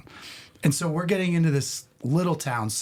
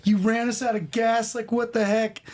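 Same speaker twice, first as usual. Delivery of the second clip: imitating an outraged friend, pitched way up; freaked-out rant; 11 semitones higher than usual. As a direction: whine in high-pitched, mock-frantic disbelief. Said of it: "I'm just letting him rant".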